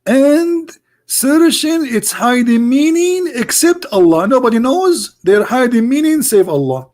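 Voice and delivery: high, slow mock preacher. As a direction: sneer it out like a pompous preacher. Mocking quote continued.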